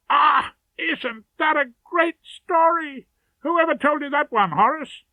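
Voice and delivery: high, lively barrister voice, slowed then quickening; a colleague gushing over a story; Vincent's eager voice. Acted. Delighted colleague asks who told the joke, high and eager.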